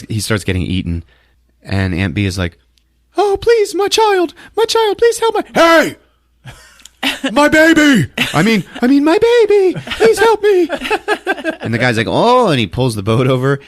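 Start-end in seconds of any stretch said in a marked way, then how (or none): 3.2-10.7 very high, loud hammed-up wailing; frantic plea. Aunt Bee fakes begging for rescue. 12.1-12.5 high, loud "oh"; mock alarm. Boatman startled by cries.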